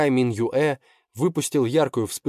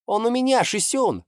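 put-on much higher, excited young shout; triumphant call; 13 semitones higher than usual. Boy grabs token, calls out.

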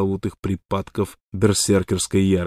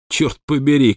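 loud outburst, a bit higher; shouted oath; 5 semitones higher, 6 dB louder than usual. A narrator's reading. Wild Water suddenly flares up, shouted oath. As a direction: burst out with a curse. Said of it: "крикнул он".

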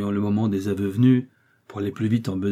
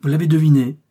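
higher, louder exclamation; crying out; 5 semitones higher, 6 dB louder than usual. A narrator's reading. Gangster telling detective he guessed right, exclaiming. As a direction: confirm it with emphatic energy. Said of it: "s'écria la terreur d'Ornano".

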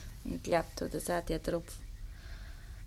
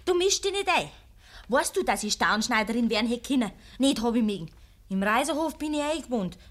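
angry retort; 4 semitones higher, 6 dB louder than usual. Acted. Zornige Entgegnung.

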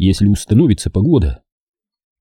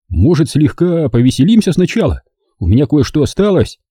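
playful offer; 6 semitones higher than usual. A narrator's reading. Narrator voices comrade.

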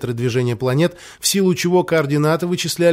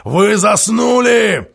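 slow furious shout, higher; angry shout; 6 semitones higher, 4 dB louder, much slower than usual. Acted. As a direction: bellow at the sleepy crew. Captain yelling at gunners.

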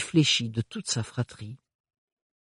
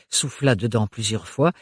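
ordinary talk

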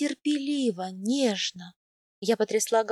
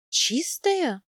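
higher, incredulous echo question; doubtful; 5 semitones higher than usual. A narrator's reading. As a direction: query the word in disbelief. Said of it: "усомнилась я".

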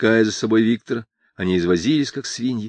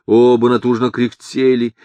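ordinary delivery

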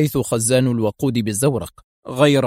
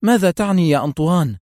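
higher-pitched, startled question; astonished; 6 semitones higher, 3 dB louder than usual. A narrator's reading. Mafia boss questioning his pilot's meaning, astonished. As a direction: ask in surprise with raised pitch. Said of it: "سأله دون كاميلو بدهشة".

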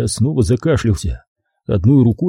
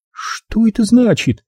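slow, very high question; high-pitched bafflement; baffled captive woman; 12 semitones higher than usual. A narrator's reading. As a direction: ask in a raised woman's voice, drawn out. Captive woman asks, uncomprehending.

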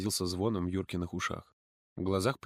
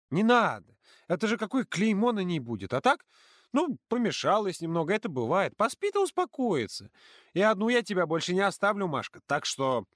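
put-on higher earnest girl's voice; earnest and worried, much higher; serious girl; 9 semitones higher than usual. A narrator's reading. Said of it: "вмешалась Полина". (Полина серьёзно объясняет подруге)

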